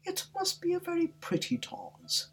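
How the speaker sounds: timid. Acted. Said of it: "said Alice timidly".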